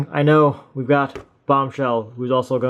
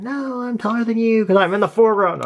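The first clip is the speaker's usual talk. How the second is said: high-pitched indignant toy voice; squeaky toy voice; 8 semitones higher than usual. Pretend. Second toy voiced answering.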